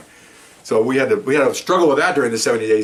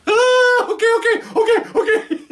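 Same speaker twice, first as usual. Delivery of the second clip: much higher, louder, frantic mock yelp of agreement; panicked giving in; 4 dB louder, slower than usual. Arrestee agrees to photo for his dog, high frantic mock yelp. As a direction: squeal and give in fast.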